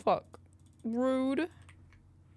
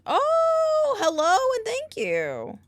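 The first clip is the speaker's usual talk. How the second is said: much higher, louder hello; excited greeting; 11 dB louder than usual. Real, not acted. Streamer greeting a new viewer, high and loud. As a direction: burst into a delighted welcome.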